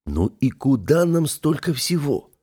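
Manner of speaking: mildly baffled, drawing the words out; puzzled. A narrator's reading. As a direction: ask it slowly, at a loss. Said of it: "озадачила меня Оля".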